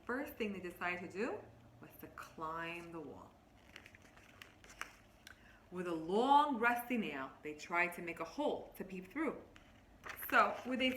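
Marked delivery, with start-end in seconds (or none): 1.8-9.3 put-on a touch louder storybook read to kids; lightly voiced story narration, drawing them in; reading to children. Teacher reading a picture book to her class, storybook voice.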